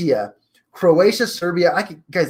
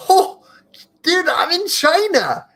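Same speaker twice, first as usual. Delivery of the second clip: delighted surprise; 13 semitones higher than usual. Real, not acted. Finds China on listener list.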